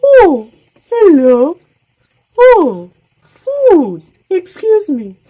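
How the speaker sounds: very high, slow squeaky bird voice; tiny bird. Acted. Small bird spotting food, very high and slow. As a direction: squeak it like a little chick.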